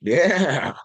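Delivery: loud, slow emphatic yeah; loud insistence. Real, not acted. Emphatic confirmation to surprised cohost.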